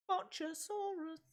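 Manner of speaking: soft, high squeal of a name; mock monster name call. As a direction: squeak the silly name out quietly, falling at the end. Mock wrestling name, echoed.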